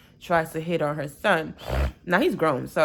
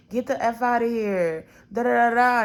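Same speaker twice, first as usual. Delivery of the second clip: a sharp first take of the curse, only a bit raised; mock cursing out; 5 semitones higher than usual. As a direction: tell him off, half-performed. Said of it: "curses the boy out".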